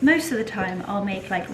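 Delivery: ordinary talk, nothing marked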